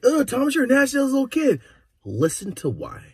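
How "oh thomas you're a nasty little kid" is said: high, loud mock scold; mock scolding mom. Mother scolding her little son Thomas, high mock scold. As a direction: squeal it like a disgusted mom. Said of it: "she's like".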